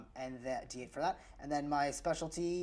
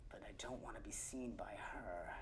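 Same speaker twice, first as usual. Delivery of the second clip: soft unvoiced whisper; sneaking player whispering; 6 semitones lower, 15 dB quieter than usual. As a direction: whisper so she won't hear. Sneaking, staying unseen.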